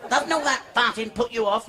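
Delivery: high put-on woman's voice; prostitute's remark. Prostitute wonders at Chalky, high put-on voice. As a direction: query him in a comic female pitch.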